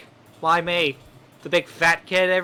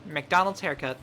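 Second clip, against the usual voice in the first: ordinary talk, nothing marked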